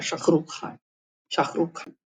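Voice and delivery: शाहरुख खान quietly, h turned into a kh sound; soft kh pronunciation. Shown as a demonstration. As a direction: model शाहरुख खान softly with kh. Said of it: "ख की ध्वनि निकाला है".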